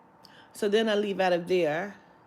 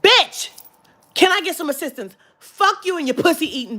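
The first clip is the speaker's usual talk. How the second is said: loud, higher angry retort; re-enacted angry demand for help; angry outburst; 6 semitones higher, 7 dB louder than usual. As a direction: snap at a useless store clerk. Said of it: "I was so fucking angry".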